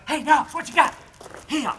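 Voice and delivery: high, loud, excited call to the dog. Shown as a demonstration. Trainer calling the dog with an excited voice. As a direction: pump the dog up with a bright call. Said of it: "Notice my tone of voice".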